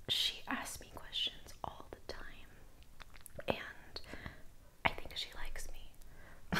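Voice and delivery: slow hushed gossip; whispering. Retold or imitated. Overheard boss gossiping.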